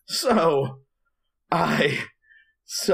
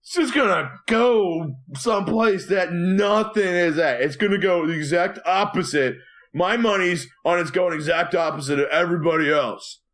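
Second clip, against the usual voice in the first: lightly voiced tipsy weather guy, a touch higher; drunk weatherman; 5 semitones higher than usual. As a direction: play a mildly drunk weatherman bragging loosely. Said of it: "there's one guy that's been drinking all night long".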